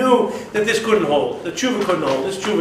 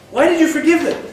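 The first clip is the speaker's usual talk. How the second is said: Yonah's complaint; 8 semitones higher, faster than usual. Acted.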